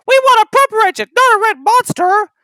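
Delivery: very high, loud and fast; whiny trucks. Acted. The trucks complaining about James, very high and fast. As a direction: whine like grumpy toy trucks. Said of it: "said the trucks".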